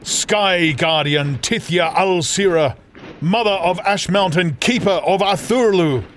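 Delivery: high, loud desperate calling out to his sister; a brother's desperate cry, pitched high; desperate plea. Acted. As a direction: plead across the sky, urgent and raw. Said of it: "his screeches became a desperate plea".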